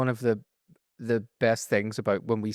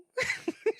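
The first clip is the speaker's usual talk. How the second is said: high giggle; 6 dB quieter than usual. Retold or imitated. Giggle in impression.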